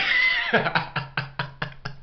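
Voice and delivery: long, high laugh; high laugh trailing away in chuckles; amused cackle. Real, not acted. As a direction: crack up at your own joke. Laughs at own accent jokes.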